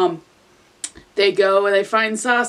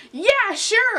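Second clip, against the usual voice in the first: excited; 11 semitones higher than usual. Acted. Excited agreement in story.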